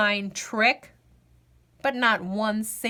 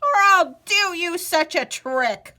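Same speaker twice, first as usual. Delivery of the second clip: loud, cracked warning; old man threatening a trick; snapping old man; 7 semitones higher, 6 dB louder than usual. Acted. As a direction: threaten him sharply. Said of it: "snapped the old man".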